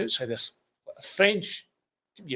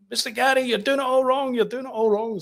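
high-pitched mock calling out; imitated shout; 11 semitones higher, 4 dB louder than usual. Winston crawling behind Gary in the van, high mock shouting. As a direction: call after someone in a high, insistent voice. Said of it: "starts shouting at the, the, the window".

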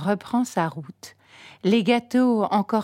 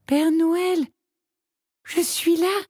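small voice; 9 semitones higher than usual. A narrator's reading. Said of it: "d'une petite voix".

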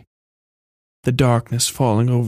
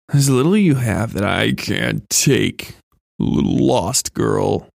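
slow menacing demon; slower than usual. A narrator's reading. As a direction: savour every word like a predator. Demon girl menaces narrator.

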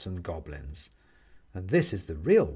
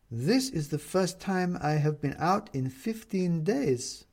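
put-on higher and slow, a careful older woman; Renata's voice; 7 semitones higher, slower than usual. A narrator's reading. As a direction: let the woman speak higher and slowly. Renata speaks in the story.

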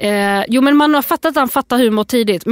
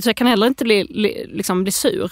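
usual speaking voice

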